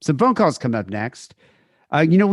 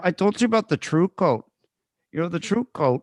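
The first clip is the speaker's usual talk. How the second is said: put-on higher Minnesota accent, quoting the line; William H. Macy Fargo accent; 5 semitones higher than usual. Quoting Macy in Fargo, a put-on Minnesota accent. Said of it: "his accent in Fargo was the best".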